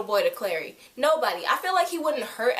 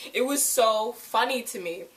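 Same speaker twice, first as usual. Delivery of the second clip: ordinary talk, nothing marked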